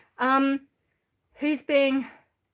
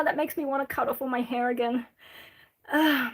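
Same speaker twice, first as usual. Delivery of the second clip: normal talking voice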